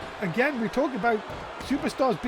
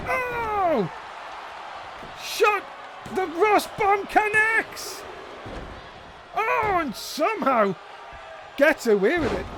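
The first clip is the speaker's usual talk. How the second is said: excited shout; 9 semitones higher, slower than usual. Real, not acted. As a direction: yell it as the big move lands. Finisher connects, near fall.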